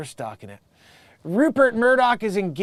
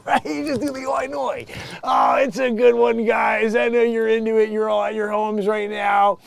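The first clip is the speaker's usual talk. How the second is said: high, shouted urging; loud hype; 4 semitones higher, 4 dB louder than usual. Acted. Comedian urges listeners at home to join in, loud hype. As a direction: call out high and loud, holding the energy.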